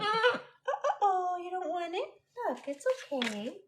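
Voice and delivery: high, soft, sympathetic coo; coddling pet talk. Real, not acted. Owner whose bird refuses banana, soft coddling.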